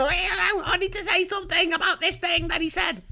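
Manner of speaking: high, prim mocking voice; prissy pip of a caller; posh radio caller. Mocking talk radio callers, high and prim. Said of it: "They went".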